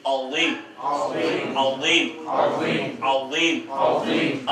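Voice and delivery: अज़ीम with a clear z sound, drawn out slowly; correct recitation. Shown as a demonstration. Teacher models अज़ीम for students, slow and exact. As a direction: say अज़ीम with the proper z, long and slow. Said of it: "सुब्हान रब्बियल अज़ीम".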